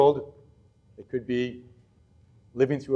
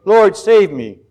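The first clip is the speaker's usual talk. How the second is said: raised, louder plea in a reading; crying out; 5 semitones higher, 12 dB louder, much slower than usual. A narrator's reading. Peter beginning to sink calls to Jesus, crying out in a measured reading. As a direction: read it as a restrained cry for help. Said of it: "he cried out".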